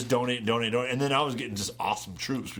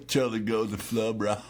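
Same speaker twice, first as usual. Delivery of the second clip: Californian hippie impression; 3 semitones higher than usual. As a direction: put on a mellow California dude accent. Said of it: "not an episode of The California".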